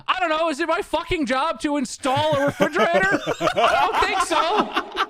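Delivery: high, laughing, slowing at the end; mock outrage with a laugh; indignant laughing comeback; indignant retort.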